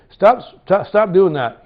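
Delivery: loud, high retold shout; shouting stop. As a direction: yell at her to stop. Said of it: "He says".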